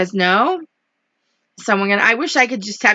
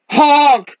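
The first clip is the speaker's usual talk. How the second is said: mild storybook yell; drawn-out truck horn, mildly raised; yelled honk; 4 semitones higher, 6 dB louder than usual. A narrator's reading. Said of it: "yelled a dump truck".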